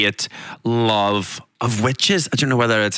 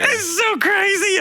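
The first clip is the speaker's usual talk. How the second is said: emotional disbelief; slower than usual. Real, not acted. Emotional about touring dream.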